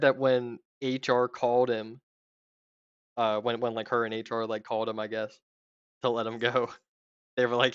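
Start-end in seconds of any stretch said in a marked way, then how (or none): none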